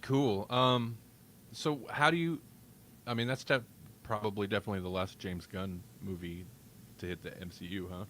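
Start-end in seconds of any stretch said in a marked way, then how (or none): none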